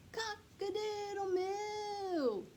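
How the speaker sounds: high, slow drawn-out moo-call; cow mooing a crow. Acted. Cow bellowing its attempt at cock-a-doodle-doo. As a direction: stretch it out like a big cow trying to crow.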